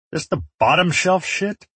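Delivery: choking lightly after a burning shot; lifting to a high, strained question; choked out. A narrator's reading. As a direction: squeeze it out past the burn of cheap liquor. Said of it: "I choked out after taking".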